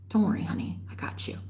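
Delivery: whispering the ex's sweet promise; mock tender whisper. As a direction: hush it like a secret reassurance. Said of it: "When men like my ex say".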